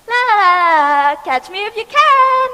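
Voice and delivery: high, loud sing-song taunt; the cake mocking the boy as it rolls away; teasing Johnny Cake. A narrator's reading. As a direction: tease the boy like a cheeky runaway. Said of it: "said Johnny Cake".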